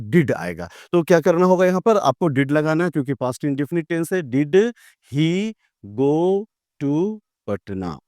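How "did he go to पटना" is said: slow dictation. Shown as a demonstration. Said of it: "dictating example sentence".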